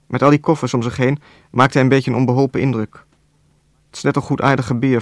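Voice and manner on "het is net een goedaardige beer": ordinary delivery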